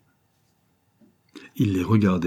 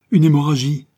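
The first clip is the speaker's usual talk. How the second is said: higher, louder exclamation; alarmed cry; 7 semitones higher, 8 dB louder than usual. A narrator's reading. Madame Cathalie crying out at the wound, alarmed. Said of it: "s'écria madame Cathalie".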